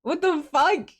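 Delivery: high-pitched exasperated disbelief; dismayed outburst. Real, not acted. Dismayed at needing French accent.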